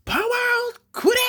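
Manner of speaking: very high, strained anime cry; slow, drawn-out cry pleading for power; yelling for power. Acted. Hero pleading for energy in the manga, high drawn-out yell. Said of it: "So he's yelling for power".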